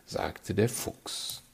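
ordinary delivery, nothing marked

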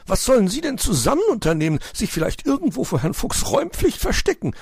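high, fast, indignant magpie; angry magpie. Acted. Frau Elster empört sich über Herrn Fuchs, erbost. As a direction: spit out the suspicion in a huff. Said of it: "stieß Frau Elster erbost hervor".

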